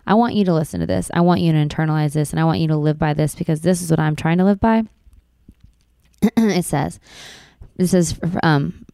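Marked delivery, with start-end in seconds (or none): none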